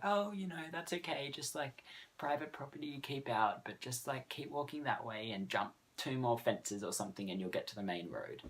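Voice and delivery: kind lady. Retold or imitated.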